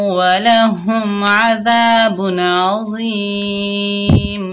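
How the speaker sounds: slow, drawn-out melodic tajweed chant; Quranic recitation. Acted. Teacher reciting verses to students in slow tajweed chant. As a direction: stretch each vowel in measured recitation.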